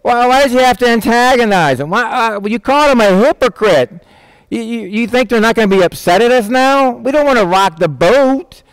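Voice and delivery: high, anxious pleading with Jesus; high-pitched panicked disciple; worried disciple. Pretend. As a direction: fret like a nervous follower begging him to stop.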